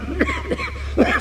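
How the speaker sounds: put-on a rough old man's cough; hacking cough. Old veteran pleading bad health, coughing fit. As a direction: cough it out, wheezy and spent. Old man fakes sciatica.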